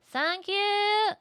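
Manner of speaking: high, loud gushing thanks; squealing thanks. Real, not acted. Streamer thanking the helpful girl, loud gushing. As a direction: gush your thanks over and over.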